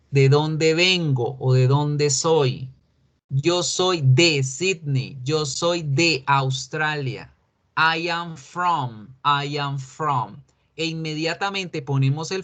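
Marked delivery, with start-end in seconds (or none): 3.3-7.3 muy despacio, marcando cada palabra; frases de ejemplo lentas. Shown as a demonstration. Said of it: "modela frases de ejemplo". 7.8-10.4 muy despacio, dictando; modelo lento. Shown as a demonstration. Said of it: "modela la estructura".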